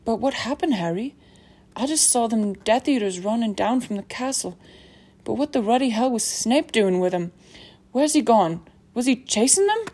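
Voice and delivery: bewildered big man, lively rising questions; agitated, puzzled character voice; Hagrid. Acted. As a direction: pile up urgent questions, pitch climbing.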